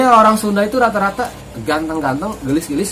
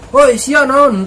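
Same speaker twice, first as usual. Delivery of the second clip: high, loud mock outburst; mock angry challenge; 7 semitones higher, 7 dB louder, much slower than usual. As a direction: call out a hammed-up angry challenge. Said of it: "nggak kayak gitu". (bentakan marah pura-pura, nyaring)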